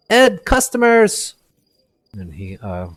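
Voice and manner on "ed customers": loud, high call to the back room; yelling to the back. Acted. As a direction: shout for his brother in the back. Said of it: "he yells".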